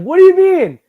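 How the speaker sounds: protesting fired doctor. Acted. Fired doctor arguing with his boss over a Yankees player, indignant. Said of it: "he would be like".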